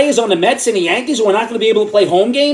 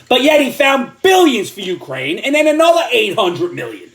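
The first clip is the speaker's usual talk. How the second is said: ordinary delivery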